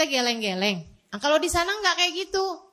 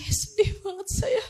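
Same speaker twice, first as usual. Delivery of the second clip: mock tearful; 7 semitones higher than usual. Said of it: "Saya bilang".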